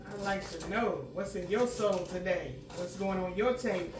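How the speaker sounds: usual speaking voice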